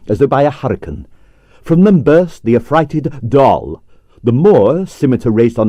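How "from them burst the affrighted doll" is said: ordinary delivery, nothing marked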